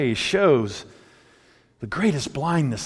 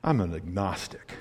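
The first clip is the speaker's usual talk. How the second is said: snooty intellectual; 9 semitones lower, 9 dB quieter than usual. Retold or imitated. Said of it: "looking down over their intellectual glasses".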